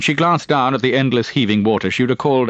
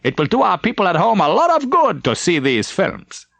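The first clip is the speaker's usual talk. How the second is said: a character voice pitched well up; higher character voice; 11 semitones higher than usual. A narrator's reading. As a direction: lift the voice high for this speaker. Character quote in narration.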